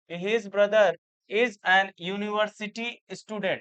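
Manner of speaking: slow, deliberate reading of an example; slow dictation. Shown as a demonstration. Teacher slowly reading the example sentence to students. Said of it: "university बोलते हैं ना".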